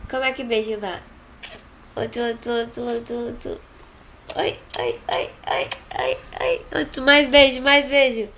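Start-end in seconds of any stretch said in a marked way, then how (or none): 4.4-6.7 put-on soft whispered oi oi to the baby; playful whisper. Whispering playfully to the little one. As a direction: hush it like a little secret game. Adult whispering to small child.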